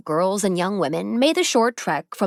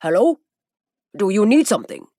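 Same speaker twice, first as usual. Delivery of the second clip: put-on Masaru in a heavy foreign accent, louder; 2 semitones higher, 5 dB louder, faster than usual. A narrator's reading. Masaru answers Zeke's call, in a heavy foreign accent. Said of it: "Masaru said in his heavy accent".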